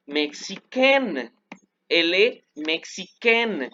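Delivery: "mexicaine" said very slowly, ending like "ken". Shown as a demonstration. Teacher shows students the feminine form, slow French. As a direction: stretch out "mexicaine" with a clear "ken" at the end. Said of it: "se pronuncia como si fuera así, ken".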